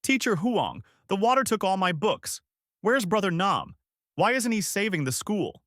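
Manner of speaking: put-on high, upset little child's voice; distressed pupil, mildly tearful; child crying out. A narrator's reading. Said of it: "little Lan crying".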